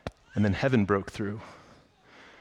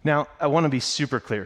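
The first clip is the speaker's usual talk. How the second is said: ordinary delivery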